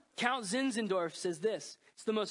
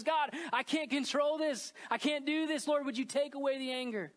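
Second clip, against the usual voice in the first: pleading prayer; 7 semitones higher than usual. Retold or imitated.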